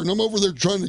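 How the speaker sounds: normal talking voice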